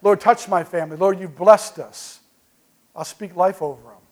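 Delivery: normal talking voice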